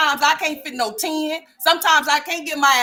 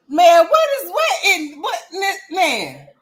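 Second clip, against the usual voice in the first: loud, high disbelief; shocked outburst; 6 semitones higher, 7 dB louder, slower than usual. Real, not acted. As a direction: blurt it out in shock. Shocked at the jeans.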